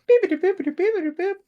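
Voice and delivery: very high, loud robotic chirps; high-pitched electronic beep imitation; excited droid beeping. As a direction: chatter like an excited little droid in squeaky beeps. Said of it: "he beeps excitedly".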